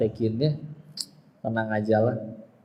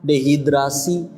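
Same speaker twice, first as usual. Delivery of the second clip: usual speaking voice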